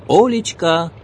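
calling out loudly across the yard; loud, high shout for his wife. A narrator's reading. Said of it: "закричал мужчина".

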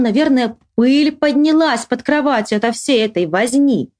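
usual speaking voice

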